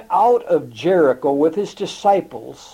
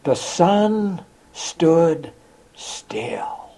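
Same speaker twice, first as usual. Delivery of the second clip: slow dramatic echo; much slower than usual. A narrator's reading. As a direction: stretch each word, drop to a hush on the last. Preacher echoes the miracle line.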